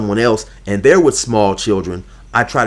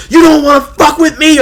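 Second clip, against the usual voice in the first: much higher, louder screamed threat; mock shouting threat; 7 dB louder than usual. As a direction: scream You don't wanna fuck with me hysterically. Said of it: "screaming and hollering".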